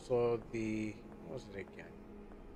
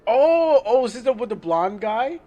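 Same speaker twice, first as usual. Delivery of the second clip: much higher and louder, eager; excited recognition; 8 semitones higher, 13 dB louder than usual. Real, not acted. Spotting the blonde guy in the game, excited and loud. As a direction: burst out as it clicks.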